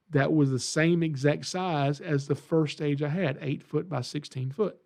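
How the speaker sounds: normal talking voice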